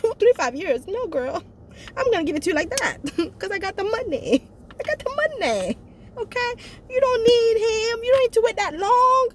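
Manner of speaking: high-pitched boastful acted voice; Harley. Acting out Harley boasting, reassuring Judith.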